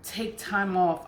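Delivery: normal talking voice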